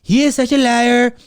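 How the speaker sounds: a mild imitation of Trump cutting in and calling someone a liar; Trump impression. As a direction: talk over the moderator like a brash politician. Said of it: "Trump is like".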